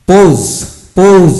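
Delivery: "পোজ" said loud and high with a long o; loud model pronunciation. Shown as a demonstration. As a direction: punch out "পোজ" for the class. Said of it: "ঔকার উচ্চারিত হয়". (জোরে উঁচু স্বরে "পোজ")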